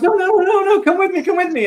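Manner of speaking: hammed-up panic begging a friend to join; frantic pleading. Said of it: "And he goes".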